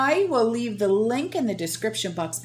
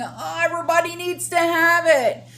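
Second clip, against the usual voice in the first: mocking quote; 10 semitones higher, 4 dB louder than usual. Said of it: "Tony Baloney ... saying".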